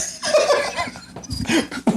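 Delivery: breathless wheezing laugh; high unvoiced laugh. Real, not acted. Laughing at his own moon rebuttal, high wheezing laugh.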